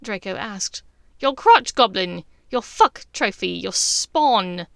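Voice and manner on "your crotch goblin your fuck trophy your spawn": mocking. Bellatrix spelling out the insult.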